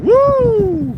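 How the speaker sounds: loud, high whoop; excited cheer. Real, not acted. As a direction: whoop at the lightning strike. Excited cheer at lightning strike.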